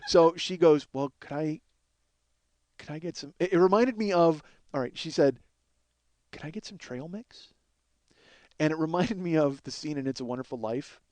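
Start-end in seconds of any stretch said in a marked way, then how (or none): 0.9-3.3 hushed, hesitant request; small tentative voice; timid imitation. Imitating timid homeless woman asking. 6.3-7.5 very soft, meek question; hushed request, lifting at the end; timid imitation. Imitating timid woman asking.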